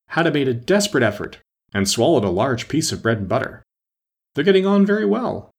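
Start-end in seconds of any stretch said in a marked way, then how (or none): 4.3-5.5 high, strangled voice; squeezed-up, choked reply; choking voice. A narrator's reading. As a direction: talk as if choking on a mouthful. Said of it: "he said in a choking voice".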